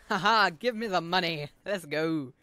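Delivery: loud, gleeful cheer after a laugh; excited celebration. Real, not acted. Gamer cheering for the money, excited celebration. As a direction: burst out loudly in triumph.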